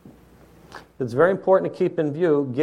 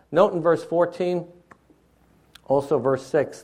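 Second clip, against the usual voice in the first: ordinary talk, nothing marked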